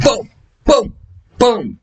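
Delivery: imitated punches in a street fight story; punch sound effects. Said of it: "I said".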